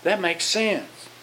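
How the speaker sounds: softly awed, drawn out; hushed little revelation; quiet realization. Acted. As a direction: let it dawn on you slowly. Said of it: "and it's like".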